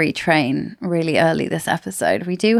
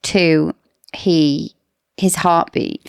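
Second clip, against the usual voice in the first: ordinary talk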